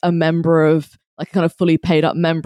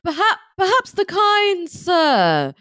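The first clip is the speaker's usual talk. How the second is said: hammy stage actor; 8 semitones higher than usual. Pretend. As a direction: play a pompous Victorian gent, hammed up. Mock archaic gentleman banter.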